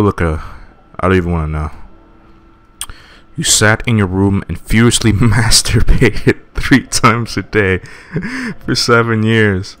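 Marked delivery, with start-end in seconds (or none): 3.4-9.8 laughing while reading. Real, not acted. Reading accusing nightmare text.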